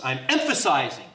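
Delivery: EMPHASIZING pushed out with emphasis. Shown as a demonstration. Preacher demonstrating what emphatic means, with emphasis. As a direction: punch the word emphasizing. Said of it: "It's with emphasis".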